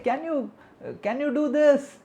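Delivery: put-on high, loud request to a child; mother calling out. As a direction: call the order across the house.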